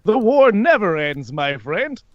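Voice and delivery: high, drawn-out theatrical greeting; swooping, showy character voice; Alastor, jovial and grand. Acted. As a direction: announce yourself in a high, lilting villain voice. Plays Alastor.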